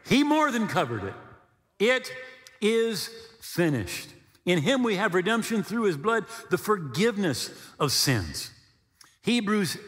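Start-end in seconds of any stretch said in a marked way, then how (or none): 1.8-4.1 is finished drawn out slowly, is leaned on; slow stress on is. Shown as a demonstration. Preacher declaring to congregation, slow stress on is. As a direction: let it land slowly. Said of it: "repeated key phrase".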